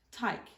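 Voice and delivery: "tyke", take said with a long I sound; broad Australian vowel. Shown as a demonstration. Presenter shows broad Australian take as "tyke", with an I vowel. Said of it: "had the sound of I".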